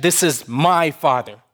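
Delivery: MY stressed to show possession. Shown as a demonstration. Preacher explaining possession to the church, stress on MY. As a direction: lean on the word MY. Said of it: "the emphasis is on the possession".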